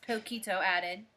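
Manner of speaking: usual speaking voice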